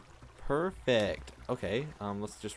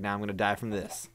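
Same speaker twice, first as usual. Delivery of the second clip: ordinary talk, nothing marked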